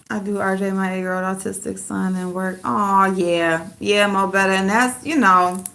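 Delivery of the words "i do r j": ordinary delivery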